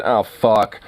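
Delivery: ordinary talk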